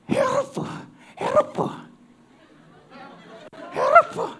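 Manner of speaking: high calls in mock Japanese. Mouse trying Japanese last, calling out high in mock Japanese. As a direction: cry out like you're speaking Japanese.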